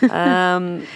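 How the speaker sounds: ordinary talk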